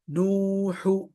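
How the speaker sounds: लूतु slowly, the pesh said with fully rounded lips. Shown as a demonstration. Teacher demonstrating full lip rounding. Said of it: "पूरा पेश होंठ गोल".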